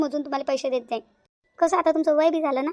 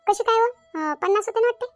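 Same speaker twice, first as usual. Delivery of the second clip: put-on higher old woman's voice, puzzled; doubtful old aunt; 6 semitones higher than usual. Aunt doubting the count to her niece, higher puzzled old voice. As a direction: question it like a forgetful old aunt.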